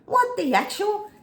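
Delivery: very high, slow incredulous; imitating someone baffled by a fake story; a hint of shocked bystander; mock disbelief. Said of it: "People are gonna go".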